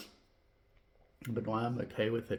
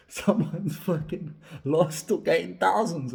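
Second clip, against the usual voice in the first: laughing; 4 semitones higher, 9 dB louder, slower than usual. Real, not acted. Laughing at bookkeeping blunder.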